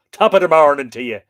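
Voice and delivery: loud, hammed-up stage-Irish accent; kitsch Irish accent. Host mocking a clichéd greeting to Irishmen, stage-Irish accent. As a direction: belt it out in a mock Irish accent. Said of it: "Tom Cruise's version of an Irish accent".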